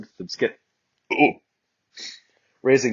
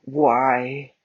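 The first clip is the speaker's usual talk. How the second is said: whined; 5 semitones higher, 4 dB louder than usual. Acted. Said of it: "I whined".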